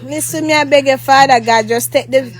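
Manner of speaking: low, pleading talk with God; informal prayer. Real, not acted. Prays to Father God.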